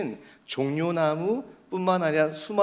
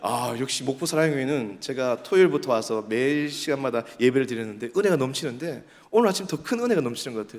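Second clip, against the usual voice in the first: ordinary talk, nothing marked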